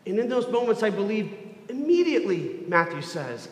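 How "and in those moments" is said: usual speaking voice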